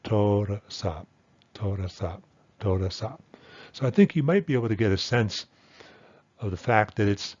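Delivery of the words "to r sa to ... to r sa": "to, r, sa" run together, "r" a tiny schwa; reduced, easier version. Shown as a demonstration. Teacher shows it gets easier and faster, "r" reduced to "ruh". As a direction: glide quickly through "r" straight into "sa". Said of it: "little bit easier and a little bit faster".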